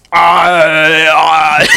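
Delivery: loud hammed-up Arnold yell; mock Arnold yell. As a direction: belt it out like Arnold in an action movie. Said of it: "He goes".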